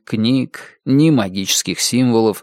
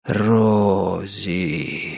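slow, drawn-out ghostly whisper; hushed, lingering otherworldly voice; whispering temple voice; 3 semitones lower, much slower than usual. A narrator's reading. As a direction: stretch each word out like an eerie disembodied voice. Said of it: "прошептал безязыкий голос храма".

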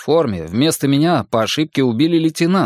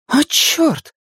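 high, shocked outburst; startled gasp. Acted. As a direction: gasp it out in alarm. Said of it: "охнула Тео".

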